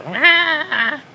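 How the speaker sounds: high, loud mocking whine; shrill Stephanie. Mocking Stephanie scolding her father, high shrill whine. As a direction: squeal it to make fun of her. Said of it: "In that shrill voice of hers".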